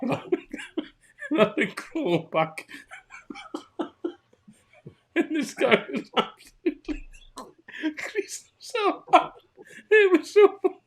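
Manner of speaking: high, breaking up with laughter; real helpless laughter. Real, not acted. Storyteller recalling his crawl back, helplessly laughing through it. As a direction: laugh so hard the words barely come out.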